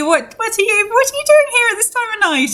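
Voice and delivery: high-pitched, quick startled question; startled villager. Acted. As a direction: blurt it out high and fast. Acting villager surprised by late caller.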